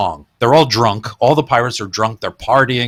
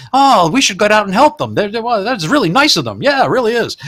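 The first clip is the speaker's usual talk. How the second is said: high, loud cheerful naive comics; high, clueless comic voices from the hole below; muffled dubbed voices; 10 semitones higher, 4 dB louder than usual. Retold or imitated. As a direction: chirp it up like fools who don't get it. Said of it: "dubbed dialogue from the hole".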